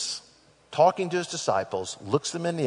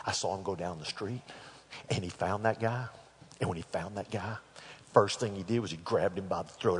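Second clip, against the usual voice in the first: low, quiet retelling as if reporting a scandal; hushed telling; 4 semitones lower, 9 dB quieter than usual. Retold or imitated. A co-worker quietly reports to the master, hushed. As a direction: keep it quiet, like passing on gossip.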